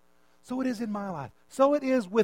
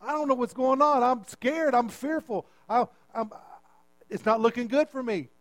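loud, pleading prayer, voice raised high; crying out to God; 4 semitones higher, 4 dB louder than usual. Acted. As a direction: call out to the Lord as if caught in a storm. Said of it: "Would you cry out".

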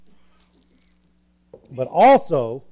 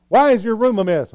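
higher-pitched mock parental nagging; exasperated parent; 5 semitones higher, 3 dB louder than usual. Parent asking about the messy room, mock exasperation. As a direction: demand an explanation from the kid.

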